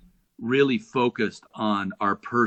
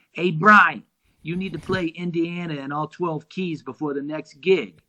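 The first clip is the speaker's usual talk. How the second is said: Johnny; 4 semitones higher than usual. Retold or imitated. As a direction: imitate the drummer calling out, then instructing in a high voice. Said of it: "Have you done your Johnny impression".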